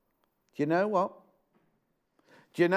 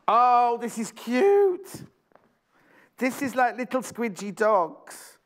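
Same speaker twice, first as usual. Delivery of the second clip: much higher, slow drawn-out cooing; delighted gush; 5 semitones higher, 4 dB louder, slower than usual. Real, not acted. Host spotting cute dog fabric, delighted high coo. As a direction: coo over it like a puppy.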